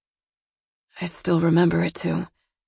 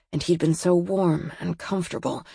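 plain reading voice

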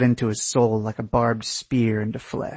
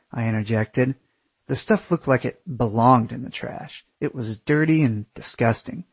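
ordinary delivery

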